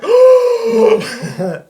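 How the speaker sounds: long high gasp of awe; starstruck gasp. Retold or imitated. A starstruck gasp at the creator's tiny booth. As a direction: gasp like meeting an idol. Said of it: "and I was like".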